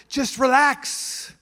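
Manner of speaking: soothing God voice. Retold or imitated. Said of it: "And God's like".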